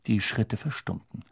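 plain reading voice

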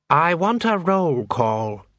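slow, measured demand from a curt master; lightly snapped, drawn-out command; mildly snapped order. A narrator's reading. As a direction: demand it slowly, mildly irritated. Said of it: "he snapped".

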